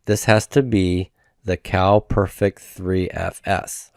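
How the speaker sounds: normal talking voice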